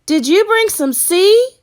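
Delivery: put-on high, loud eager child's cry; excited cry. A narrator's reading. Caleb greeting Sarah, loud excited cry. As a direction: call out, bursting with hope. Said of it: "cried Caleb".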